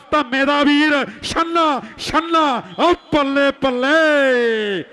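slow drawn-out high cheer; excited hype call. Real, not acted. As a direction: stretch it out, cheering the crowd. Commentator hypes the raider.

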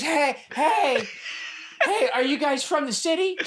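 high, drawn-out mock call; imitating the stranger. Stranger calls to the table after dinner, high imitation. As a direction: call over in a put-on high voice. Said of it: "this is how she, she goes".

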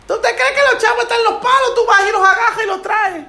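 high-pitched imitation of a wife; nagging wife. Wife challenging husband about money, high mimicked scolding. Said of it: "mira cómo me dijo mi esposa".

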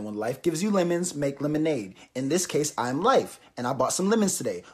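mock wisdom. Mom's mock wise speech.

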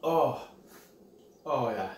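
ordinary delivery

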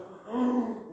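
sharp gasp of alarm; dramatic gasp. Acted. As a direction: gasp as if shocked. Snake revealed in story.

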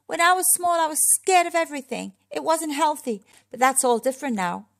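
insistent, high call, easing off at the end. A narrator's reading. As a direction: call it down to friends below. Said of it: "he called down to them".

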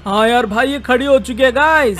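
normal talking voice

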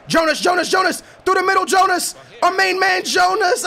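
high, loud cheering of a name; excited shout. Real, not acted. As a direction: cheer him on loudly. Cheering on his striker.

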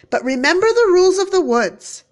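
put-on mother warning her child. A narrator's reading. Mother warns daughter.

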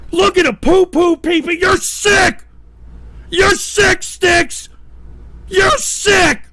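outraged high-pitched shout; disgusted shouting. Real, not acted. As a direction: hurl it as an accusation, pausing between bursts. Accusing someone who mocks him.

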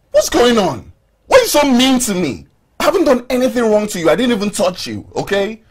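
high-pitched, frustrated pleading; aggrieved arguing. Acted. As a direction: protest your innocence in a heated quarrel. Hurt man argues back in quarrel.

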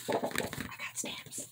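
slow, drawn-out whisper. Real, not acted. Crafter telling viewers about stamps, slow whisper. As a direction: whisper it softly, stretching the words. Whispers on after apologising for shouting.

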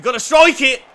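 frustrated fan shout; urging shout. Real, not acted. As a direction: bark at him to shoot. Fan begging for a shot.

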